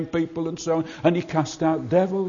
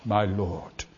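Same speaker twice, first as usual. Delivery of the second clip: hushed awe; 8 semitones lower, 8 dB quieter than usual. Acted. Blind man worships Jesus.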